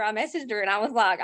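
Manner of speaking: ordinary talk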